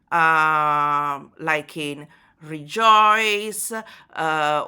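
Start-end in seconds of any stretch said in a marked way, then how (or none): 2.4-3.7 rejoice said slowly, joice hit loud; slow rejoice with the weight on joice; stress on joice. Shown as a demonstration. Lecturer showing strong closing stress, slow rejoice. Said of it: "allowing a strong stress to close the line".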